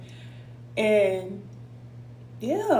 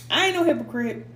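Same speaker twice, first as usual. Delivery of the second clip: ordinary talk, nothing marked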